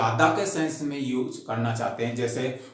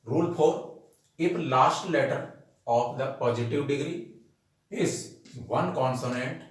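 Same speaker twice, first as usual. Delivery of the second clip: slow rule dictation; much slower than usual. Acted. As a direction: dictate the rule word by word for notes. Dictates next rule slowly. (छात्रों को notes लिखवाते हुए बहुत धीमा, ऊँचा श्रुतलेख)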